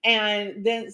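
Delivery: usual speaking voice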